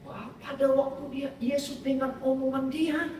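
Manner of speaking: ordinary delivery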